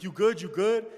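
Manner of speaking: loud, high, urgent "you good?"; asking fast if he's hurt; urgent check-in. Retold or imitated. Friend checking if he's hit.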